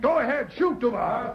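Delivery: high, urgent yelled order; frantic shout. Acted. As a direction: yell it frantically.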